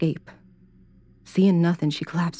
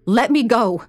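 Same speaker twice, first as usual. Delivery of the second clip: desperate shout; 6 semitones higher, 5 dB louder than usual. Acted. Captive Karen demands release.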